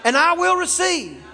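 loud, high call for the crowd to repeat; shouted declaration. Shown as a demonstration. Preacher leading the congregation in a shouted declaration. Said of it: "Come on, just say that".

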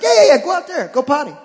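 very high, loud laughing encouragement; dad egging on his dog to potty; laughing cheer. Acted. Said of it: "I'm like".

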